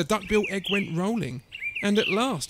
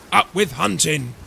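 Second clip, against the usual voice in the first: loud, gruff-edged rallying chant; forceful storybook battle cry; shouting; 6 dB louder than usual. A narrator's reading. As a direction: shout it like a war slogan. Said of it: "they shouted".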